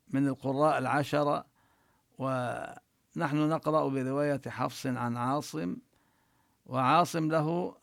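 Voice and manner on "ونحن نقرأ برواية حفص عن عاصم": ordinary talk, nothing marked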